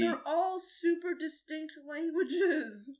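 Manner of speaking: loud indignant rebuttal; exasperated protest. Real, not acted. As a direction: stretch it out in protest. Arguing back at the advice.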